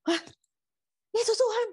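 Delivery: very high incredulous question; acted startled double-take; challenging disbelief. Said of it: "nantang Tuhan balik ya kayak begini".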